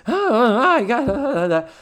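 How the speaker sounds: high, loud flustered oh. Retold or imitated. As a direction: caught off guard, fumbling. Imitating flustered Mrs. Garrett.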